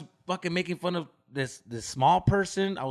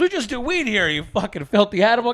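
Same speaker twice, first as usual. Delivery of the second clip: mock scolding; 3 semitones higher, 6 dB louder than usual. Continues scolding the girl.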